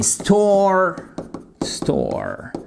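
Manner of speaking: "store" with a sounded American r; American pronunciation. Shown as a demonstration. Teacher showing learners the US word, American pronunciation. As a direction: say "store" in an American accent, r held. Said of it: "very American".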